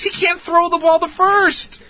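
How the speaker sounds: very high, drawn-out exasperation; exasperated complaint. Acted. Host listing the team's problems, exasperated. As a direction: let it climb into a slow high wail.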